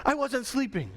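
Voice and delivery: high-pitched mock student protest; hammed-up indignant talking back; arguing student. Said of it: "Arguing instead of just obeying".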